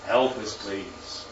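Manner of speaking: low, hushed appeal fading on please; humble plea. Acted. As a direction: plead quietly, letting the voice drop away. Voicing the lepers' humble plea.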